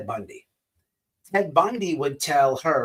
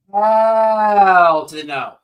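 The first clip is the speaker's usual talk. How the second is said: "Hell to the no" stretched very slow and louder; loud drawn-out refusal; 3 semitones higher, 8 dB louder, much slower than usual. Real, not acted. Refusing to let the psychic attack the subscribers, loud and drawn out. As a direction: drag out every word of "Hell to the no" as a big slow loud final no.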